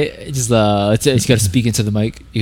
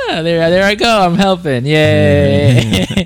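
mock cheer; 5 semitones higher, 4 dB louder, much slower than usual. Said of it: "it's like".

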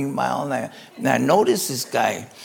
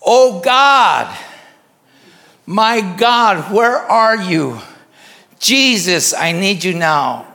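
loud, high cry out to God; acted anguished shout, easing at the end; imitated desperate yelling; 3 semitones higher, 7 dB louder, much slower than usual. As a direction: yell to heaven, drawing the words out. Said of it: "Then he starts yelling".

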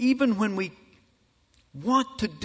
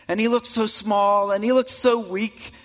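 louder, higher, swelling toward a roar; preaching at full force; 6 semitones higher, 7 dB louder than usual. Acted. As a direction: climactic sermon build. Preacher builds to climax.

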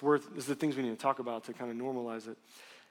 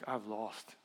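defeated; 4 semitones lower, 9 dB quieter than usual. Retold or imitated. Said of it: "felt defeat".

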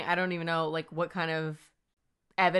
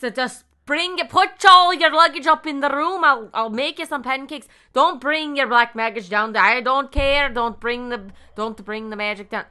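high, loud Scottish landlady; a wobbly Scottish accent all over the map; 8 semitones higher, 11 dB louder than usual. Pretend. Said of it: "I'm Scottish".

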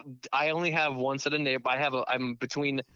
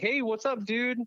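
casual dude greeting; 7 semitones higher than usual. Retold or imitated. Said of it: "Do you talk to them like".

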